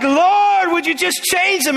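high, loud pleading congregant begging the Lord; whiny plea to God. Acted. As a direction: beg God like a frustrated churchgoer.

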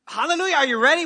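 rousing preacher call. Acted. Preacher calling out to congregation.

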